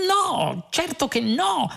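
high, chirpy denial; chattering, high-pitched innocence; garrulous. A narrator's reading. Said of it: "rispose Zaphod garrulo".

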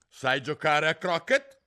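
high, loud, slow imperious question; queen demanding. A narrator's reading. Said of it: "queen addresses Alice".

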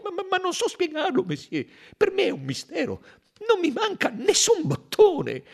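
high, fast, faltering denial; stammering bewilderment. Acted. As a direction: stumble over the words, baffled and scared. Accused conductor stammers denial.

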